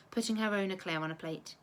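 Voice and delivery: ordinary delivery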